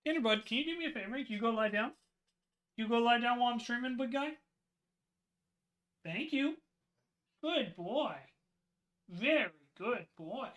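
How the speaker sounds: quiet, quick coaxing voice; soft coaxing to the dog. Real, not acted. Streamer quietly asks his dog Tanner to lie down while streaming. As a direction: gently coax the dog to lie down.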